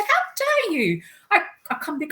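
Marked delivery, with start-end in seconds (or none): 0.0-1.0 very high, drawn-out indignant outburst; outraged, stretched-out challenge; really angry. Retold or imitated. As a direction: re-enact a fiery telling-off, slow and high. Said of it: "I was really angry".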